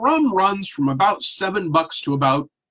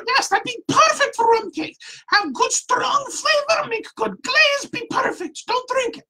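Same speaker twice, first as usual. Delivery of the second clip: put-on squeaky old woman. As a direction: squeak out the verdict like a stern tiny old woman. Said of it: "this high-pitched, squeaky voice".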